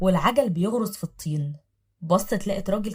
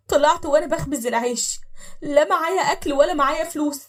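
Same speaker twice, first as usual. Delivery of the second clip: high, loud aggrieved complaint; weary lament; 7 semitones higher, 4 dB louder than usual. Acted. Wife telling the old man her husband robbed her. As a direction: pour out the grievance.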